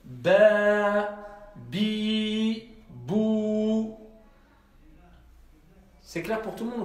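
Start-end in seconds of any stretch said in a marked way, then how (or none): 0.0-4.0 "Ba, bi, bou" each vowel held long and level; teacher model, drawing out every vowel; slow model reading for the class; long vowels demo. Shown as a demonstration.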